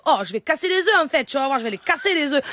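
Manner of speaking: put-on singsong accent of the South of France; southern French accent. Mother cooking for her children, southern French accent. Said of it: "maintenant l'accent du Sud".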